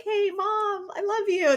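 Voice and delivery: put-on high, sugary kid voice; imitating sweet kids. Mother imitating the kids she wished for, high and sweet. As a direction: mimic a child greeting mom sweetly.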